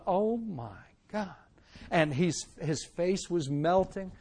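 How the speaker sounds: soft slow awed oh my God; hushed awe. Retold or imitated. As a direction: barely breathe it out, stunned. Awe at the preacher's face.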